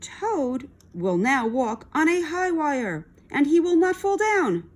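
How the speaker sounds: high, building announcer call. A narrator's reading. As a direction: announce the feat grandly. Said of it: "said the voice".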